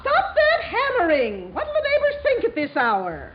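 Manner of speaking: scolding. Acted. Mother scolding noisy son.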